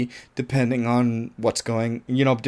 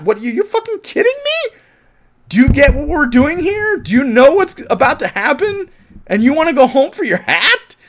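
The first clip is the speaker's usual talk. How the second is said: high, loud exasperated scolding; 13 semitones higher, 6 dB louder than usual. Real, not acted. Reviewer scolding the character over her hat, high and loud. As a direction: tear into her, half laughing.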